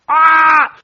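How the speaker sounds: loud, high yell of a quarrel; hammed-up argument scream; acted scream. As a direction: scream it out as in a shouting match. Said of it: "o a gritos".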